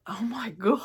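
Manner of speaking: a sharp gasp, then a breathless whispered oh my God. Real, not acted. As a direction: catch your breath in awe and whisper it. Gasps opening the package.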